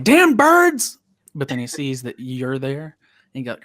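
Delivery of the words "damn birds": high, loud exasperated outburst; annoyed yell. Acted. Worker grabbing a broom at the birds, annoyed yell. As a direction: shoo off pests with a sudden yell. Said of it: "he's like".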